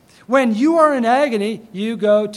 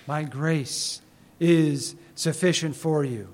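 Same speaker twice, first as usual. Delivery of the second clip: slow hushed verse; 7 semitones lower, 5 dB quieter, slower than usual. Shown as a demonstration. Slow quiet quoted verse.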